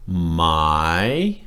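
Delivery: long "my" starting low and climbing; drawn-out, rising "my". Shown as a demonstration. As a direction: stretch "my" into a clear upward glide. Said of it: "rising tone".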